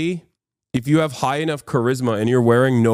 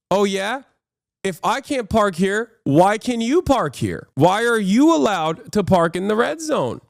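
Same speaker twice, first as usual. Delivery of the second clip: a lightly voiced smug challenger, higher; the driver taunts the cops, lightly mocking and higher; mocking jerk; 8 semitones higher than usual. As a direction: throw the question back at them with a smirk. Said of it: "the asshole character says".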